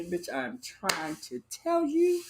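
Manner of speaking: high and slow, stretching it out; high, slow, emphatic telling; high drawn-out emphasis. Real, not acted. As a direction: draw every word out, way up high. Emphatic admiring aside.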